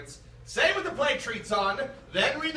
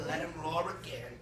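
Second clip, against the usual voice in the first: quiet; 9 semitones lower, 9 dB quieter, faster than usual. Acted. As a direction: Let him roar again said low and soft. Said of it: "contrasted with the roared take".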